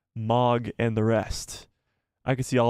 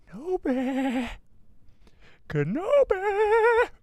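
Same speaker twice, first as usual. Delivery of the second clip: rising from higher to shrieking, slow and stretched; building Maul scream; much slower than usual. Acted. Second Maul attempt for Pam, building to a scream. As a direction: climb each name until it cracks into a scream. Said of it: "Should I try it again?".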